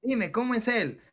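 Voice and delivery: man teasing his silent partner, high and loud; teasing banter. Retold or imitated.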